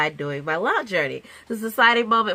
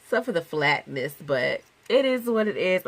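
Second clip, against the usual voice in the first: normal talking voice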